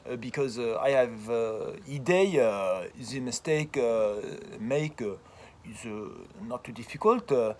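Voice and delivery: halting English in a French accent, full of uh; a French speaker fumbling through English, in French accent. Shown as a demonstration. Professor shows how French speakers sound in English, French accent. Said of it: "uh, imitate, uh, French, the way they speak".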